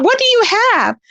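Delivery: high, challenging retort; mock incredulous. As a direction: act out disbelief at her claim. Retold challenge to the happy claim.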